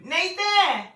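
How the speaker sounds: high-pitched real holler of a name; calling out. Real, not acted. Mother holding baby summons someone, calling out high.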